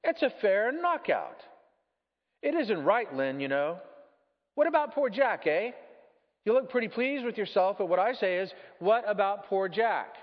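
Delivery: high, loud, outraged ghost; indignant ghost. Acted. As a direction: protest as an affronted ghost.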